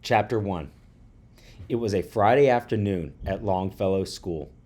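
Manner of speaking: usual speaking voice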